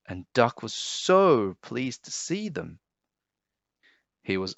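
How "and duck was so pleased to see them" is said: plain reading voice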